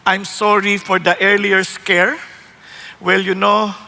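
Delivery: low, slow captain's voice; deep calm announcement over the speaker; pilot on intercom. Retold or imitated. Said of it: "Sasabi nung kapitan". (mababa at mabagal na boses ng piloto)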